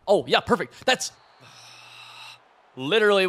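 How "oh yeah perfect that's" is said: higher, louder delight; excited cheer. Real, not acted. Gamer watching his blitz work, excited. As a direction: cheer the play. Defense works perfectly.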